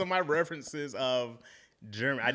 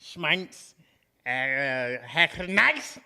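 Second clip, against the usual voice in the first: slow, higher-pitched mock-German gibberish; horrible fake German; 4 semitones higher, much slower than usual. Guest asked to fake speaking German, horrible mock-German accent. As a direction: bark nonsense in a bad German accent. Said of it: "a very horrible rendition of this".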